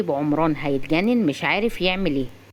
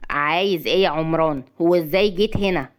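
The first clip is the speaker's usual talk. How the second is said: ordinary delivery, nothing marked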